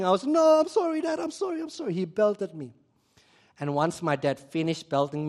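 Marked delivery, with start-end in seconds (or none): 0.2-1.9 high, pleading child's sorry, mock sobbing; imitated crying plea. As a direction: beg Dad like a crying child, pitched high. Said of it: "And I was crying".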